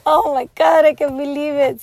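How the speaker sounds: real dismay. Real, not acted. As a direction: cry out at the broken necklace. Necklace just broke.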